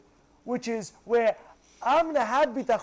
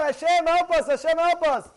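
loud, high cry for help; acted, insistent plea hurled upward; screaming out; 5 semitones higher, 5 dB louder than usual. Trapped people calling to Hashem, acted scream for help. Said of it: "they could scream out".

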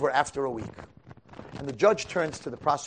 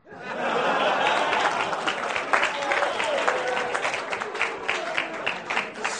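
long breathy unvoiced laugh, pitched up; big laugh; 10 semitones higher than usual. Real, not acted. Preacher cracking up at his own joke, laughing hard.

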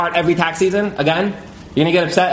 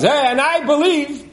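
high, slow mimicking of the stubborn caller; lightly voiced know-it-all on the phone; a hint of a whiny caller pressing his point; mock caller; 11 semitones higher, slower than usual. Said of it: "and he goes".

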